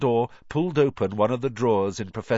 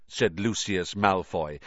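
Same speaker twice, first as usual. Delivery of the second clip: ordinary delivery, nothing marked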